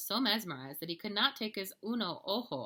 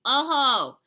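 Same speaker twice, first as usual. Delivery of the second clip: loud, higher holler; 7 semitones higher, 16 dB louder than usual. A narrator's reading. Martians hollering back at the dogs, very loud. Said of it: "the Martians hollered".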